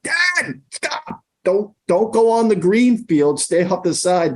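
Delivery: high-pitched strained mock shout; imitated kid's yell. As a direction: imitate a son urgently warning his dad, voice way up high. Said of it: "We will never forget Cam yelling".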